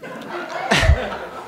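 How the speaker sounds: soft voiceless giggle; breathy laugh. Real, not acted. Laughing about being wrapped in dough.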